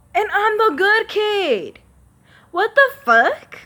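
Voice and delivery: high, loud and slow indignant outburst; hurt fury at being passed over; outraged. Real, not acted. Venting about unfairness.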